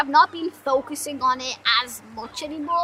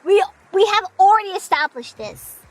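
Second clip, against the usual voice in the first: ordinary talk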